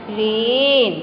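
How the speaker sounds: रेन with its vowel held long; long vowel sound. Shown as a demonstration. Teacher reading the word again for pupils, drawn-out vowel. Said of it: "then it produce long sound".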